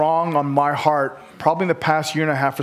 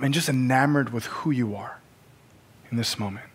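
hushed prayer; 4 semitones lower, 4 dB quieter than usual. Acted. Pastor softly praying over the congregation, hushed. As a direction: pray quietly over the room.

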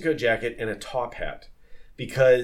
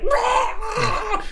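mock startled yell; 4 dB louder than usual. Shocked at being kissed.

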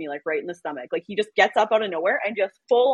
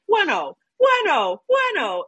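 slow, high, drawn-out mock Italian buenos; hammed-up fake Italian greeting; a partner faking Italian, slow and high; 12 semitones higher, 4 dB louder, slower than usual. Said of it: "he's like".

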